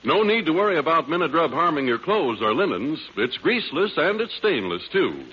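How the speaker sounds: ordinary talk, nothing marked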